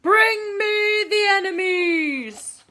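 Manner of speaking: high, loud, slow roar; big booming tiger roar; roaring command. Acted. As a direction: roar it up at the sky.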